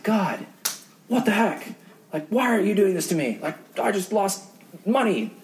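a retold frustrated cry of what the heck; frustrated complaint. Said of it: "I was so frustrated".

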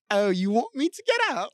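high-pitched mock British accent; squeaky bad British accent; put-on British accent. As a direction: play awkward dodging in a silly British accent. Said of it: "They start speaking in a different accent".

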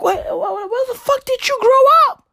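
shocked, laughing disbelief; incredulous. Retold or imitated. Comic grilling a picky eater, high incredulous cry. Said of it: "And I'd be like".

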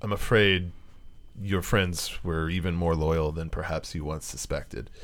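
a hint of a solemn book, low and soft; talking book. Book speaking inside the character's head, lightly voiced and solemn. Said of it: "the book says to you in your head".

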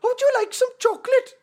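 high-pitched, loud fake Swiss; Swiss accent. Friend trying his exotic accent again, high mock Swiss. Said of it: "That's Swiss".

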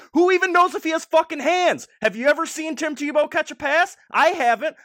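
high, loud furious shout. Real, not acted. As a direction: yell it in outrage. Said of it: "I'm so furious about this".

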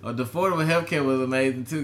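ordinary delivery

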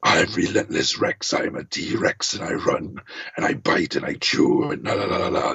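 deep, raspy T-Rex villain voice; Relentless Rex. Shown as a demonstration. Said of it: "it's kinda like Shredder".

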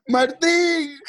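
high-pitched mock call through the house; imitated yell. Friend's mom calling her husband, high imitated yell. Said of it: "escuchábamos a la mamá de Walter".

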